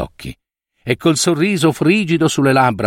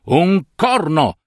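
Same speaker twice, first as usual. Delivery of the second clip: angry shout; 6 semitones higher, slower than usual. Acted. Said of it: "gridò".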